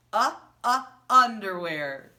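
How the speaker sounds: loud punched "uh, uh, underwear"; loud sounding out. Shown as a demonstration. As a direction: hit the first sound hard. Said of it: "sounding out".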